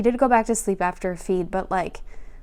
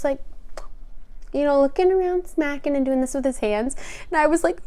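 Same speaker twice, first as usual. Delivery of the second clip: normal talking voice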